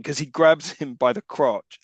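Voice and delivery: usual speaking voice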